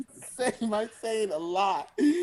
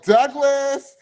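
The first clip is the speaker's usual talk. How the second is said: mock call, higher and louder; 8 semitones higher, 6 dB louder than usual. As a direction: call his name across the woods. People calling out for him.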